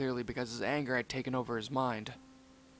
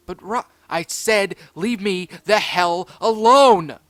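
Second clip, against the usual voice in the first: high, very loud, slow scream; a pup screaming to be left alone; 6 semitones higher, 14 dB louder, slower than usual. Acted. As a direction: scream him away. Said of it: "Rocky screamed".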